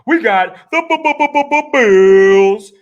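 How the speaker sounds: loud, slow, high stuttered hype call; hype announcer call. Acted. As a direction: drag out the Bills like an arena announcer. Hype reveal of his pick.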